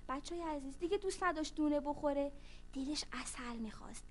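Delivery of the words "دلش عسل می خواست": unvoiced hush; whispered aside. A narrator's reading. Storyteller whispering the ant's wish to kids. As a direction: whisper it like a secret.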